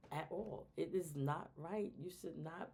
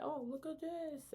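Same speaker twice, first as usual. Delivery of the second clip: put-on very high, eager kid voice; imitating excited friend; 14 semitones higher than usual. A friend showing a phone to your child, very high and eager. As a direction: sell it like a kid showing a secret. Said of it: "your friend gonna be like".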